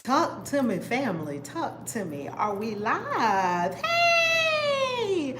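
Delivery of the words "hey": high, bright cry of hello; excited greeting. Real, not acted. Greeting viewers joining the livestream, with a high cry. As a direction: shriek a happy hey to the chat.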